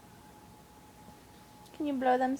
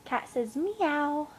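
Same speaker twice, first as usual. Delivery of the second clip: put-on slow, drawn-out meow for a toddler; slow animal sound demo; 4 semitones higher, slower than usual. Shown as a demonstration. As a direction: stretch meow out slowly. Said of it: "No, cow says moo".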